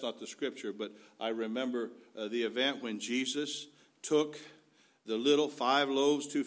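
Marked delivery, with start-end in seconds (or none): none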